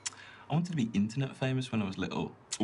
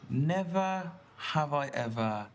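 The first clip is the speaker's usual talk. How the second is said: drawn-out, weighty delivery; slow and deliberate; 2 semitones higher, slower than usual. Acted. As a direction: stretch each word out slowly. Slow game prompt.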